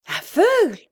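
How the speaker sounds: high, anguished cry of disbelief; a torn, piercing cry of horror; heart-rending cry. A narrator's reading. Sister hearing the terrible news, heart-rending cry. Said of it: "s'écria Henriette d'une voix déchirante".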